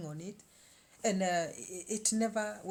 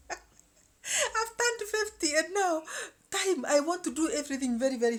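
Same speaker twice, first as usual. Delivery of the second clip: dramatic announcement; 9 semitones higher, 6 dB louder than usual. Real, not acted. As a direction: stretch it out high like a big reveal. High slow dramatic announcement.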